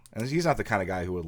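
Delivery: ordinary delivery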